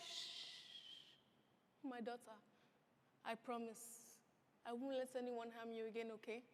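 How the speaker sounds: soft whispered comfort; tender hush. Acted. Father soothing his hurt daughter, soft and tender. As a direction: hush her gently.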